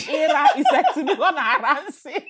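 high, giggling delivery; laughing. Real, not acted. Teacher laughing at the poop insult, giggling. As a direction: say it while laughing hard.

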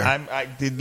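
ordinary delivery

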